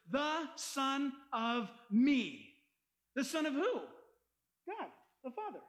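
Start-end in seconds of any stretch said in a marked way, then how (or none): 0.1-2.6 "The Son of Me" drawn out very slowly, each word weighted; slow, deliberate "Son of Me"; stress on the phrase. Shown as a demonstration. Preacher stresses the Greek meaning to listeners, very slow. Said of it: "the original word is".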